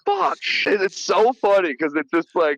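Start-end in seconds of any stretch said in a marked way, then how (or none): 0.1-0.4 high, short mock yelp; yelled curse. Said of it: "he just yells".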